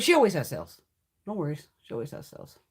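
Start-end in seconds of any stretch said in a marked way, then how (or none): 1.8-2.6 softer repeat, breathed under the breath; repeating the remark in a whisper to herself; whispered aside. Real, not acted. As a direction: say it again almost to herself. Repeated line, softer take.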